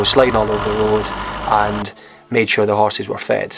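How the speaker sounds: ordinary talk, nothing marked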